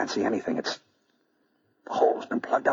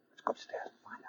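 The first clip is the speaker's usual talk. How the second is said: whisper; 12 dB quieter, slower than usual. Acted. Sneaking up to the apartment.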